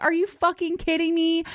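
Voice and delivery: high-pitched disbelieving complaint; retold exasperation. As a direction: squeeze it out high, fed up. Stranded, exasperated at truck.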